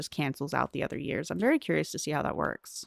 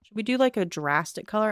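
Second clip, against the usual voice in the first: normal talking voice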